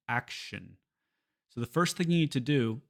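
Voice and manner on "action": action with sh in the ending; English SH sound. Shown as a demonstration. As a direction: say action with a hushing sh, not S. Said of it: "switch from that S to that sh".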